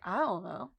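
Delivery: soft, sheepish "I don't know" with a low fall; sheepish mumble. Retold or imitated. Fiona answering her boyfriend, soft sheepish mumble. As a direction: drop it low and quiet like you're caught out.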